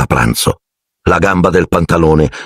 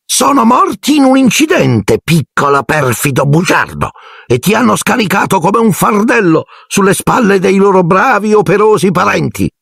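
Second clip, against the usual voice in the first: furious shriek; 14 semitones higher than usual. A narrator's reading. Aunt Marge shrieks furiously at Harry. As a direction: scream the insult back at him. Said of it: "strillò zia Marge furiosa".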